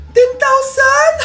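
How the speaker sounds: gasp, then high, drawn-out disbelief; amazed. Acted. Neighbor handed a big cash gift, high-pitched astonishment.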